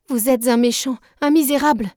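put-on girl finally shouting back in rage; outraged cry. A narrator's reading. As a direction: hurl the insult back at him. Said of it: "m'écriai-je".

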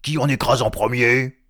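loud, high troll question; eager troll. Acted. Troll voice asking whom to crush first, loud eagerness. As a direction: call it out to the other trolls.

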